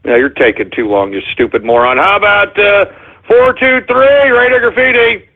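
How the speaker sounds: ordinary talk, nothing marked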